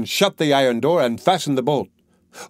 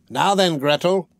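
higher, impatient witch call; 6 semitones higher than usual. A narrator's reading. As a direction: snap at her as the witch, pitched up. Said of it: "she cried to the girl".

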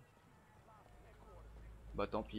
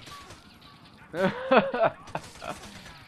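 loud laughter; 11 semitones higher, 11 dB louder than usual. Real, not acted. Laughing after shooting.

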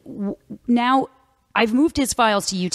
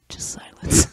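whispered; slower than usual. Shown as a demonstration. Demonstrating silence after a question.

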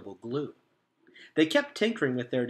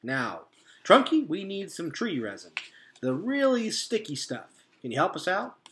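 hearty beaver; 5 semitones higher, 6 dB louder than usual. A narrator's reading. Mister Beaver introducing Trunk Tap, loud and hearty. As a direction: introduce your pal with gusto.

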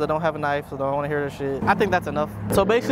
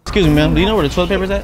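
loud; 2 semitones higher, 9 dB louder than usual. Acted. Loud prank in quiet library.

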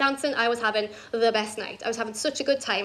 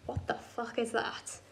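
much softer, slow mock disbelief; stunned hush; 3 semitones lower, 12 dB quieter, slower than usual. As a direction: say it slowly under your breath. Retells man's stunned first reaction.

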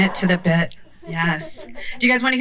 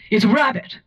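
lightly voiced haughty old lady; dry old-timey put-down; old-timey Charlotte. Charlotte teasing mom about getting lost, old-timey. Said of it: "old-timey voice".

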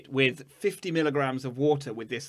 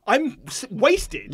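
mock drunk whine; 10 semitones higher, much slower than usual. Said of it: "I'm like".

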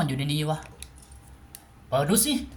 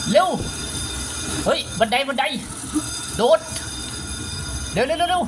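panicked urging; 6 semitones higher than usual. Real, not acted. Fleeing the monster in panic.

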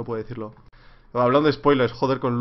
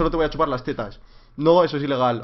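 normal talking voice

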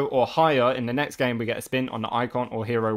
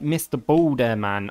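normal talking voice

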